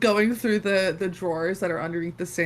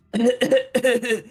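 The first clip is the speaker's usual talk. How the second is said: loud theatrical throat-clear; 3 semitones higher, 6 dB louder than usual. Acted. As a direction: clear your throat self-importantly. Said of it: "Clears her throat".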